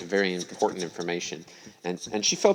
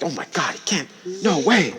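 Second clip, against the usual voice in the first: higher, acted gasping disbelief; shocked; 9 semitones higher, 5 dB louder than usual. Woman told that he's dead, shocked. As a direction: rise into a shocked protest. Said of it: "And she shocked".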